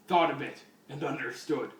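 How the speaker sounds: normal talking voice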